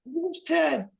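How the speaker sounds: high, wavering spirit-reader voice; psychic medium voice. Pretend. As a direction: channel spirits like a stage psychic. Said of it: "I do readings".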